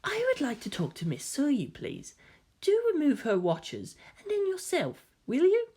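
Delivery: high, soft and poised; a lightly mocking lady voice; genteel high voice, calm and sly; dainty feminine voice. A narrator's reading. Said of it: "Karu held up a dainty hand".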